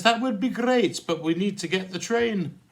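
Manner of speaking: high, loud Glasgow lassie; comic falsetto-like lassie; lassie's voice. Acted. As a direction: put on a high-pitched girl's reply in mock Glaswegian.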